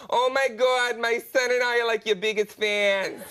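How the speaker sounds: put-on starstruck masseuse in a Boston accent; excited Boston fan accent. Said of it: "I know that accent".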